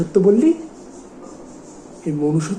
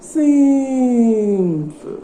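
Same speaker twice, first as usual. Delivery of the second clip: very slow, high, stretched single word; drawn-out conclusion; 6 semitones higher, much slower than usual. Acted. Boss wraps up his explanation to Bablu, drawling it high. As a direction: stretch it out as the obvious answer.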